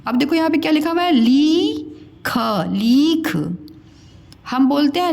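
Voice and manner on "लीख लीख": लीख with a long drawn-out ee; गलत लंबी ई. Shown as a demonstration. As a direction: stretch the ई in लीख. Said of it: "उच्चारण में कम समय लगता है". (लीख में ई खींचकर)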